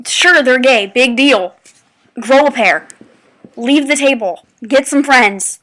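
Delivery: loud, punchy dismissals with pauses between; forceful, fed-up scolding; exasperated telling-off. Real, not acted. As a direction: tell someone off, hitting each short phrase hard. Telling off the staring kid.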